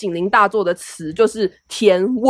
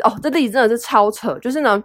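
ordinary talk, nothing marked

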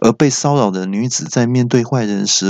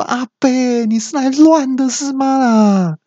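put-on much higher yell at the fleeing old man; shouting out the door; 14 semitones higher than usual. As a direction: yell after him down the street. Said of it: "对着门外大喊说".

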